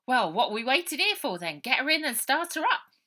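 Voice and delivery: loud high brisk command; loud impatient order; impatient urging. A narrator's reading. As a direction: hurry everyone along. Baby urging them off.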